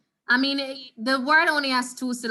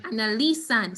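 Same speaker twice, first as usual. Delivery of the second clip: usual speaking voice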